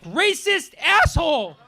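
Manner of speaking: high, slow mock-outraged woman yelling; angry heckler. Retelling a heckler, high mock shout. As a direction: shriek the accusation in a put-on voice. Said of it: "she was like".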